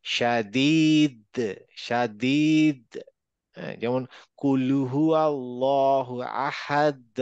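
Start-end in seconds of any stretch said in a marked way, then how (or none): 0.1-2.8 very slow "শাদীদ", long ee, final দ bounced; qalqalah push. Shown as a demonstration. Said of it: "এরকম একটা ধাক্কা হবে". 4.4-7.1 slow measured recitation, "আহাদ" ending in a bounced দ; pushed final echo. Shown as a demonstration. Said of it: "এরকম একটা ধাক্কা হবে".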